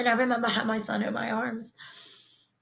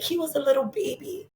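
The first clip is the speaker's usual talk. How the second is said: high, pained retold protest; 7 semitones higher than usual. Grieving mother protesting to God about her baby, pained. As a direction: plead it upward in disbelief. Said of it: "I remember being angry at God".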